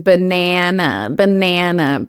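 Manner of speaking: banana with a broad nasal a, slow; really American banana. Shown as a demonstration. Exaggerating her most American banana, nasal a. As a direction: push the a of banana up the nose. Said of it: "If I'm really, really American".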